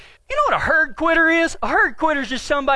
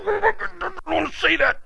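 strained higher voice under a heavy load; mock straining; 5 semitones higher, 3 dB quieter than usual. Teenager grabbing a heavy hay bale, mock straining. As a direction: act out struggling with the lift.

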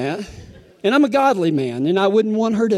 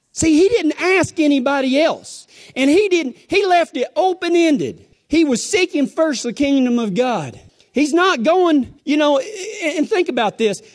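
higher, urgent preacher's voice; preaching at full force; 8 semitones higher than usual. Acted. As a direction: press it on them, pastor in full flow.

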